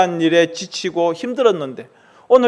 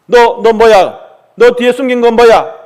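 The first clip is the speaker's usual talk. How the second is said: loud, sharp angry questioning; angry demand; 4 semitones higher, 7 dB louder than usual. Acted. Father confronting his son over the hidden book, loud angry demand. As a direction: bark at the boy to show what he's hiding.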